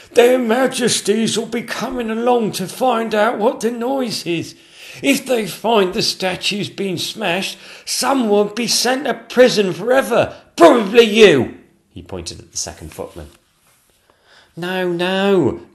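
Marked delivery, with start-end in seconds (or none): 0.1-11.6 very high, loud, frantic put-on voice; a flustered courtier warning in alarm; panicking Lord Chamberlain. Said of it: "gasped the Lord Chamberlain". 14.5-15.6 high, loud protest; a frightened servant refusing; alarmed gasp. Acted. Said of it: "gasped the footman".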